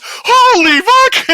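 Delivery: very high, drawn-out shout; full-blast acted shriek of shock; starstruck scream. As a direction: imitate a fan screaming in disbelief. Repeating the stunned fan's scream.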